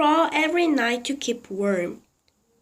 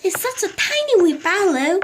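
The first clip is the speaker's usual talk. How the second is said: high, hurried wish; fretful old lady; 7 semitones higher, 4 dB louder, faster than usual. A narrator's reading. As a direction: rush it out, longing. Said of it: "Missus Silver said".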